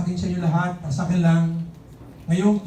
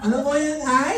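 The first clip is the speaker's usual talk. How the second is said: higher shouted reply; nasal-speech son; 8 semitones higher than usual. Retold or imitated. Son answering the father, higher mock shout. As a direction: yell back from inside the house. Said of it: "Sabi ng anak niya".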